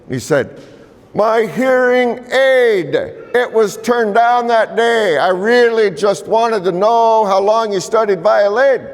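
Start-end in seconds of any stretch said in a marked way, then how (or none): 1.1-8.9 put-on high, slow old man explaining; old elder's voice. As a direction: speak up high like a hard-of-hearing elder. Elder explains his hearing aid.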